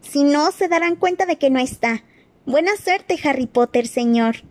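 high squeaky elf voice; chillido de elfo. Acted. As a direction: squeal it like an anxious house-elf. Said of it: "chilló Dobby". (voz de elfo chillona y aguda)

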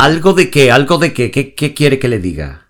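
high, loud shouting back. Acted. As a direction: yell back at him. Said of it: "veo que también sabe usted usar est".

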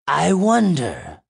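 high, slow, loud creaking hag; ancient monster voice. A narrator's reading. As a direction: drag it out like an old monster. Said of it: "gravelly and ancient like the first".

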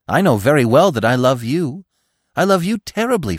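loud, rising declaration of love; insistent. A narrator's reading. Bambi declaring love to Feline, insistent. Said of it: "Bambi insisted".

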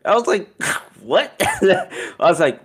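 high laughing squeal; giggling mid-story. Real, not acted. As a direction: laugh breaking through the words. Laughing while retelling.

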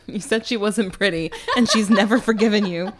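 loud, exasperated through laughter; laughing scolding. Real, not acted. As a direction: scold him while cracking up. Laughingly scolding the absent character.

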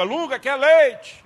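drawn-out mock-polite asking; hammed-up wife; sweet slow question. Woman's question in joke.

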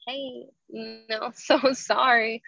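mock embarrassed apology to the teacher; sheepish apology. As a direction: back down with an awkward laugh.

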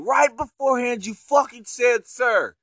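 a touch of outraged accusing; imitating an angry accusation. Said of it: "He said".

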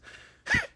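squeaky high, loud giggle. Acted. Ruff giggling as the vacuum tickles him.

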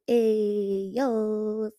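ordinary delivery

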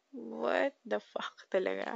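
whispered in disbelief; stunned whisper. Retold or imitated. Whispering to herself when the boyfriend refused. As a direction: breathe it out slowly, stunned.

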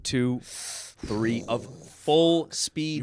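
drawn-out slow counting; counting out the seconds of falling, slow. Shown as a demonstration. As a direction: stretch each number like a long fall. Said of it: "counting the fall".

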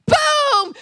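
high, loud "boom"; shouted explosion. Acted. Preacher sounds two cries colliding, explosive shout. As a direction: burst it out like a blast.

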